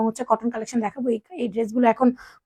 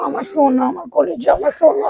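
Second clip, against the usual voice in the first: tender comfort; 3 semitones higher than usual. Real, not acted. Mother soothing her hurt little girl with pet names.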